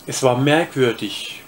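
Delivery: usual speaking voice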